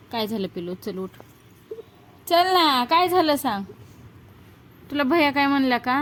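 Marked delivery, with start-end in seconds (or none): 2.3-5.9 much higher, louder pleading call; mother loudly begging her sulking daughter to answer. Real, not acted.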